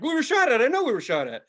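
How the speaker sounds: high, loud insistence; paranoid insisting. Retold or imitated. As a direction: insist in a tripping panic. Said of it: "I'm like".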